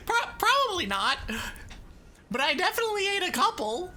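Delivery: amused, laughing as you own up; laughing admission. Real, not acted. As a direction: confess through the giggles. Admits it between laughs.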